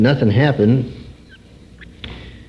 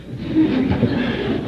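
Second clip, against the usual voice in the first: laugh; 11 semitones higher, 5 dB quieter than usual. Real, not acted. Laughing at the boss's remark.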